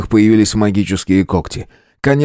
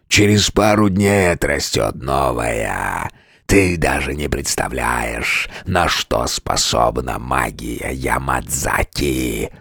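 deep, slow, much softer monster; mocking beast, low and slow; 9 semitones lower, 5 dB quieter, slower than usual. A narrator's reading. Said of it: "monster keeps speaking".

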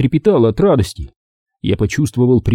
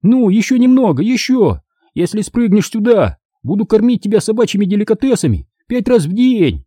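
high, eager bargaining plea; coaxing; 9 semitones higher than usual. A narrator's reading. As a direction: beg and bribe the dog, voice lifted. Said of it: "упрашивал я его". (упрашивает, заманивает собаку)

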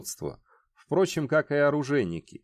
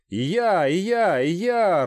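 louder, eager voices chiming in; loud agreement; 5 semitones higher, 4 dB louder than usual. Acted. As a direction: call out your support. Voices of support ring out.

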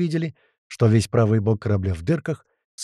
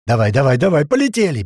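loud command climbing higher; urgent shouting; 4 semitones higher, 6 dB louder than usual. Acted. Tuman bangs on the platform roof, urgent shouting. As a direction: urge the pilot on, shouting.

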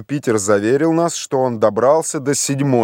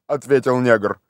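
usual speaking voice

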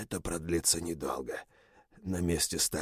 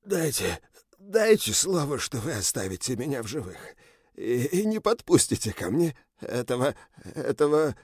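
high, panicked begging; terrified pleading; 5 semitones higher than usual. Acted. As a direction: beg for your life in terror. Beaten general begs for his life.

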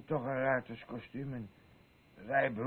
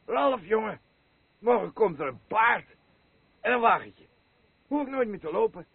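higher, louder gleeful news; excited boasting; 7 semitones higher, 11 dB louder than usual. Acted. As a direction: burst out with the news of the horse. Excitedly telling Ralf about horse.

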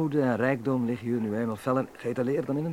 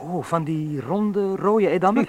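slow then louder, higher delight; excited; 6 semitones higher, 7 dB louder, slower than usual. Acted. Manfred delighting in Harm's mother's cheese, eager and loud. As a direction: savour the thought of cheese.

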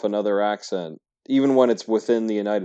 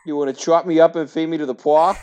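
high, loud mock Boston accent; 7 semitones higher, 6 dB louder than usual. Mocking actors' attempts at Boston, exaggerated Boston accent. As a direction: ham up a bad Boston accent. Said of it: "terrible Boston accent".

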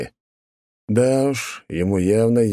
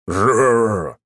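growling rat screech; 3 semitones higher, 4 dB louder than usual. Acted. Rats attack with strange noise.

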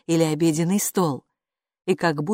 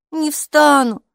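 grumble; 9 semitones higher, 7 dB louder, slower than usual. Acted. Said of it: "буркнула Хила".

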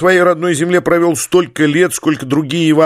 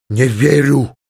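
stubborn defiance; slower than usual. Acted. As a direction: deny it with animal stubbornness. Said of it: "упрямо, со звериным нежеланием умирать". (упрямо, по-звериному)

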